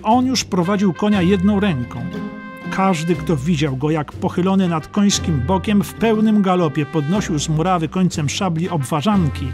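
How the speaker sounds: ordinary delivery, nothing marked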